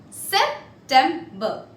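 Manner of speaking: SEPTEMBER split as Sep, tem, ber; syllable-by-syllable demo. Shown as a demonstration. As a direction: break "September" into three separate syllables. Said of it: "September is pronounced as".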